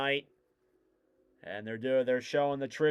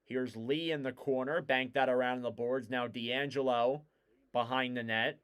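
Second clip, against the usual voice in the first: brisk hockey commentary, rising on chances; live play-by-play; 4 dB louder than usual. Real, not acted. Commentator calls the Islanders game for listeners, live play-by-play. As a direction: follow the puck, lifting the call as danger builds.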